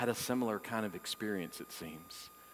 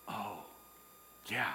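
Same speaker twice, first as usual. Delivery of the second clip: ordinary delivery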